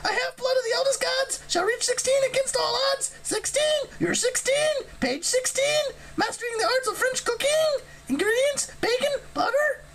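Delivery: very high, chanting harpy voice; high sing-song harpy recital; harpy reciting. A narrator's reading. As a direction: recite it to the sky like a prophecy. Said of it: "started reciting to the clouds".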